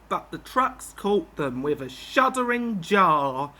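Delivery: ordinary delivery, nothing marked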